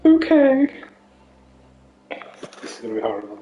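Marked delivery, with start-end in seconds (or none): 0.1-0.7 high posh lady voice. Pretend. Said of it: "do this voice for ages".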